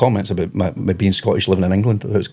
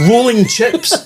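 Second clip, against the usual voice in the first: high, drawn-out incredulous echo; baffled fryer; 13 semitones higher, much slower than usual. Acted. Frying guy answering the counter girl, high slow bafflement. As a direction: repeat it slowly, high and hammed-up.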